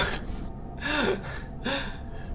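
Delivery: high airy giggle; laughing out of breath; breathless laugh. Real, not acted. Laughing at chat joke.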